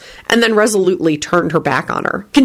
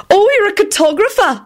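very high, interested response; keen interest. A narrator's reading. Bea responds to Lupin's talent, high and interested. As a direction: pipe up with eager interest. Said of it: "Bea responded with interest".

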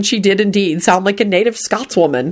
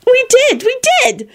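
giggled. Acted. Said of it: "she giggled".

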